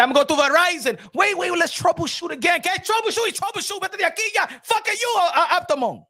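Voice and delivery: hammed-up yelling, switching into Spanish; mock angry rant. Boxing streamer retelling yelling at his internet provider, mock angry rant. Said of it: "I said".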